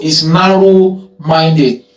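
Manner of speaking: drawn-out, deliberate "narrow-minded"; slow emphatic answer. Shown as a demonstration. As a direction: stretch out "narrow-minded" slowly, as the key term. Teacher stresses answer to own question.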